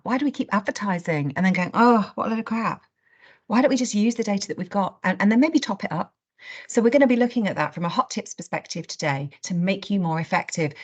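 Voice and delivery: normal talking voice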